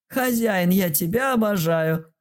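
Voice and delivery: very high squeaky little dragon voice; joyful squeal. A narrator's reading. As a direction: gush it out adoringly. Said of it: "радостно ответила дракоша". (радостный писк дракончика)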